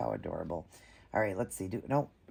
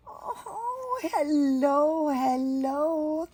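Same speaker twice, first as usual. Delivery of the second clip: cooing greeting; 4 dB louder, much slower than usual. Real, not acted. To the doll at the face reveal, high cooing hello. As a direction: greet the newborn face with delight.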